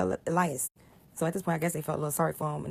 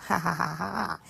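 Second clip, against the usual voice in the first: mocking laugh. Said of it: "wagging their heads".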